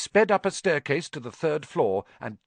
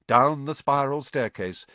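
usual speaking voice

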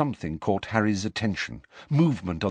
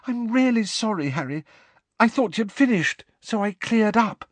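put-on very high-pitched girlish apology; apologetic, aghast; 12 semitones higher than usual. A narrator's reading. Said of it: "said Hermione with her hands over her mouth".

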